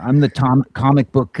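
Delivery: ordinary delivery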